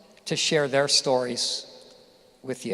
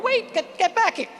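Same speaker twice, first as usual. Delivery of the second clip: very high mock pleading call; frantic parent. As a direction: call after the kid in mock panic. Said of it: "you'd go".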